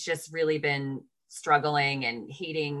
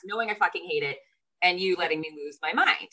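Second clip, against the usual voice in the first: ordinary talk, nothing marked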